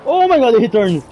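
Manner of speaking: real fright at a game enemy; startled gasp. Real, not acted. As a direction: jump at the scare. Startled while playing horror.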